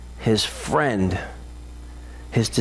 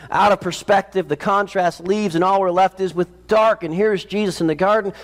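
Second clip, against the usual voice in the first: ordinary talk, nothing marked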